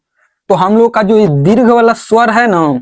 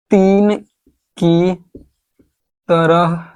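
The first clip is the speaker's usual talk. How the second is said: slow dictation; much slower than usual. Shown as a demonstration.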